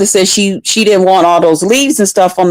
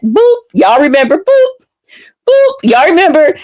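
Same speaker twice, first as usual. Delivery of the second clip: high mock beeping boops; playful high video game boops; game beep imitation; 7 semitones higher than usual. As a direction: chirp it like an old Atari game. Imitating old video game sound.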